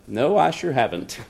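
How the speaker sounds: low, quiet deadpan; understated, low-voiced refusal; deadpan reply. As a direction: answer softly and drily, unbothered. Deadpan reply in retold argument.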